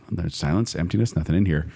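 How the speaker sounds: ordinary talk, nothing marked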